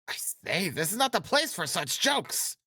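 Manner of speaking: very high, squeaky character protest; high-voiced Achilles, objecting. A narrator's reading. Narrator voices alarmed Achilles.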